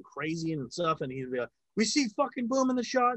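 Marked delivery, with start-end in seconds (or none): 1.8-3.1 high-pitched mock yell; retold yelling director. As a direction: imitate an angry director yelling at crew. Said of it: "screaming and yelling at my boom operator".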